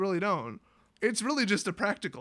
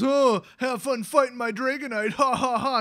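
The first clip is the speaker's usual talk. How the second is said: mocking yell; 4 semitones higher, 5 dB louder than usual.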